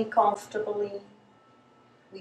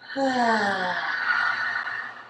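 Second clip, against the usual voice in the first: long falling open-mouthed exhale; drawn-out breathy "ha"; releasing sigh; 2 semitones higher than usual. Shown as a demonstration. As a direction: sigh out all the tension. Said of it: "using the exhalation as a way to release".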